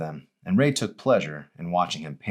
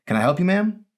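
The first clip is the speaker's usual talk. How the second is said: lightly raised, polite clerk voice; a hint of an eager shop boy; light young male voice; 13 semitones higher, 5 dB louder than usual. Narrator voices Arson.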